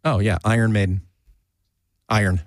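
usual speaking voice

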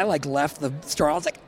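ordinary talk, nothing marked

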